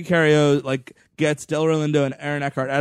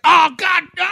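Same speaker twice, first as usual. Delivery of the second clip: high, loud hammed-up cry; mock dying scream; mock death cry; 13 semitones higher, 7 dB louder than usual. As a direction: wail as if being crushed. Retelling character's dying scream.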